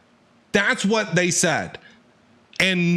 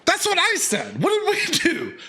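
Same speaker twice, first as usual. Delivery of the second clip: much higher, whiny squeak of innocence; mock indignant protest; 14 semitones higher than usual. Gamer protesting to Lynette, high mock-innocent whine. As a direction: play the wronged kid, voice pitched way up.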